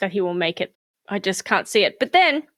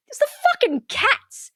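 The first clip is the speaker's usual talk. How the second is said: exasperated comic reveal; 13 semitones higher than usual. Real, not acted. Comic reveal, high pitch.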